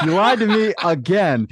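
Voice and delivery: high, loud mock outrage through laughter; laughing accusation. Man retells accusing the chatbot of lying, laughing loudly. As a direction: cry it out while cracking up.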